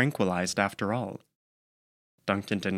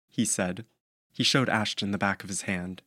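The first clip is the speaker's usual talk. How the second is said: ordinary delivery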